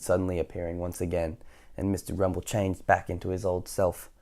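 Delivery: ordinary delivery, nothing marked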